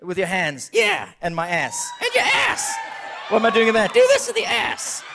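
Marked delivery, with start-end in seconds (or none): 0.7-1.1 much higher cartoon wolf voice; a wild puppet wolf yelling. 2.0-2.7 much higher cartoon wolf voice; a wild puppet wolf yelling. 3.9-5.0 much higher cartoon wolf voice; a wild puppet wolf yelling.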